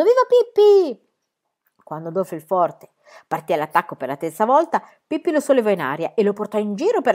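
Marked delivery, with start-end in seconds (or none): 0.0-1.1 much higher, slow cheering crowd. A narrator's reading. The spectators cheer Pippi together, shouting. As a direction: chant like a whole audience.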